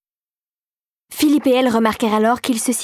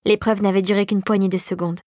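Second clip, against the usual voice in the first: ordinary delivery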